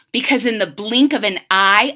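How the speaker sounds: usual speaking voice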